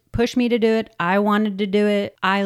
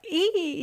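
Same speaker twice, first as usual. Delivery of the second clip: high squeal; 10 semitones higher, 3 dB quieter than usual. Retold or imitated. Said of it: "I was just like".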